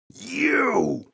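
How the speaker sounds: high, loud furious shout. A narrator's reading. Father spotting the gorilla at his grill, furious shout. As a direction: explode at him. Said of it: "shouted Father".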